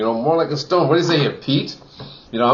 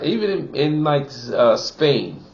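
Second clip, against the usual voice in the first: ordinary talk, nothing marked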